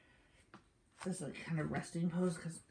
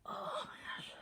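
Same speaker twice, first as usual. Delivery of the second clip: straining; 5 dB quieter than usual. Real, not acted. Straining in a hard stretch.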